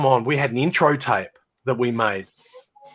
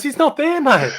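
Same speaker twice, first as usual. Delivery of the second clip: high-pitched, urgent retort; alarmed insistence; 13 semitones higher than usual. Retold or imitated. Bandmate insisting the other player is missing, alarmed. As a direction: act out a panicked bandmate.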